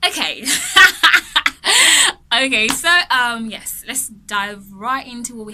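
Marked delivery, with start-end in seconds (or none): 0.5-2.3 laugh. Real, not acted. Laughing before lesson starts.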